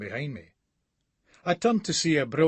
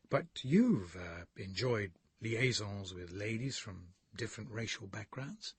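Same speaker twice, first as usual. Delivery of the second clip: hushed and much softer, almost breathed; Bladesy whispers his question much softer; whispering; 3 semitones lower, 8 dB quieter than usual. A narrator's reading. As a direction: drop to a nervous hush. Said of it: "Bladesy whispers".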